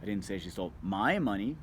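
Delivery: my hit hard, the rest light; stress on MY. Shown as a demonstration. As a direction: lean on the word my. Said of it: "seven different meaning ... word you emphasize".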